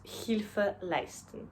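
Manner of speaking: ordinary talk, nothing marked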